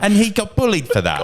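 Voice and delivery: high, wounded-parent put-on voice; aggrieved gremlin parent. Gremlin parent telling off the neighbours, mock aggrieved.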